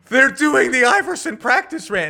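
high, loud burst of recognition; high excited gasp of discovery; excited realization. Retold or imitated. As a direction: blurt it out as it dawns on you. Retold sudden realization, gasp.

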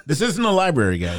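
high laughing joke line. Real, not acted. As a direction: laugh through the words. Joking, laughing at the rule.

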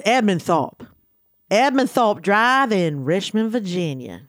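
put-on slow Virginia accent, r dropped in Thorpe. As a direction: say it like a Virginian, "Thawp". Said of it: "saying it with a Virginia accent".

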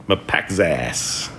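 "Mapekzas" very slow, with k and z run together; sounding out a name. Shown as a demonstration. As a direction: try "Mapekzas" slowly, long final -zas. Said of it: "I say it different ways".

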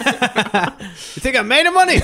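high, slow mock outrage; hammed-up parental indignation; exasperated parent. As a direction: scold like a parent asked for cash. Voicing an outraged parent, joking.

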